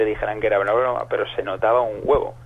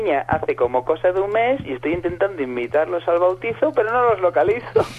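female prank caller; 6 semitones higher, 4 dB louder than usual. Retold or imitated. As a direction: pretend new mother inviting to christening. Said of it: "y me dice". (imitando, aguda y lenta, a la falsa prima)

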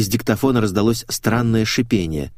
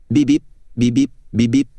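punchy spoken device beeps; imitated beeping; 4 semitones higher, 4 dB louder than usual. A narrator's reading. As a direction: beep like a phone alert, in pairs. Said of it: "донеслось из звукозаписывающего устройства". (пищит, как сигнал телефона)

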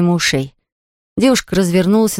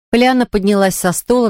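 ordinary delivery

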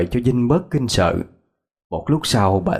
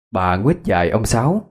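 plain reading voice

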